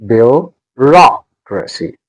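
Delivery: "Bureaucracy" slowly split, bu... ROC... racy; stress on second syllable. Shown as a demonstration. Teacher breaking the word up, "Bureaucracy" as bu, ROC, racy. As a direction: pause round a strong ROC in "Bureaucracy".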